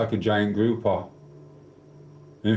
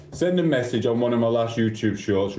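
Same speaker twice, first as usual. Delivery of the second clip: normal talking voice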